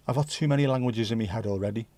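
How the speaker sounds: ordinary talk, nothing marked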